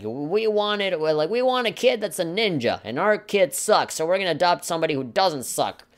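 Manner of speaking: mock the snobbish parents who only want a ninja. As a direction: parody of status-hungry adoptive parents.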